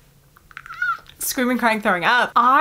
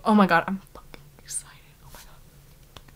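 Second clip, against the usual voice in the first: breathless, thrilled whisper; excited whisper. Real, not acted. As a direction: whisper as if you can barely hold it in. Thrilled about reading the book.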